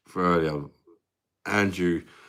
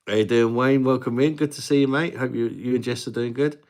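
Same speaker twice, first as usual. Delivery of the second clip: excited welcome; 6 semitones higher, 6 dB louder than usual. Real, not acted. Greeting a viewer on stream.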